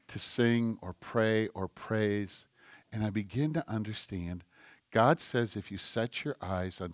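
soft, low, intimate devotional voice; hushed prayerful preaching. Acted. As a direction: draw them into quiet prayer.

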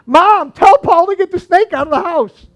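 imitated high, very loud yell for help; panicked yelling. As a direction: yell like a terrified mother up on the piano. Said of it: "started yelling".

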